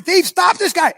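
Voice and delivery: high, loud call for someone to stop him; calling out to passersby to stop the thief; yelling. Retold or imitated. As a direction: shout to the crowd to grab the runner. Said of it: "I'm yelling".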